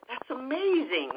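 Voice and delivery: high-pitched marvelling; amazed. Retold or imitated. Reacts to the coincidence.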